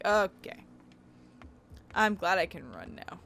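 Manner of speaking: ordinary talk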